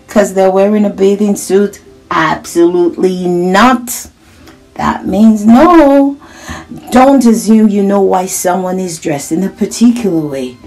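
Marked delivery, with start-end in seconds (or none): 4.8-6.2 louder, higher and slow, every word firm; slow emphatic teacher warning. Acted. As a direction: lay it down slowly like a rule. Firm teaching point to children.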